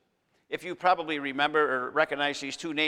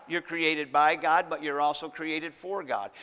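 normal talking voice

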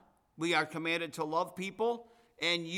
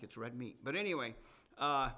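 ordinary delivery

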